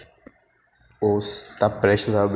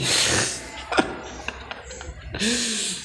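breathy laugh; 10 semitones higher, 6 dB quieter than usual. Real, not acted. Laughs at his own story.

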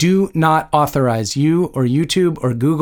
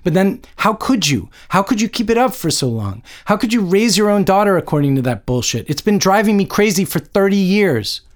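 high-pitched exasperated confrontation; anguished outburst at a parent; 7 semitones higher than usual. A narrator's reading. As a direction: demand answers in hurt frustration. Daughter confronts mother in rain.

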